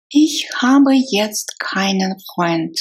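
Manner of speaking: ordinary delivery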